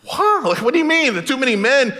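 high, incredulous wow; a hammed-up Gideon gaping at the order; mock surprise. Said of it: "I think the Hebrew word here is".

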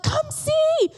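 put-on high, excited child shouting; mock excited child's shout; excited preschooler. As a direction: squeal it like a thrilled little kid. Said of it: "he's like".

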